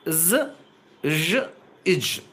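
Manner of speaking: జ్, జ్, ఇజ్ buzzed separately as "z", "zh", "ij"; isolated consonant sounds. Shown as a demonstration. Teacher demonstrating the three voiced sibilants, isolated sounds. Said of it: "ఈ మూడు సౌండ్లు".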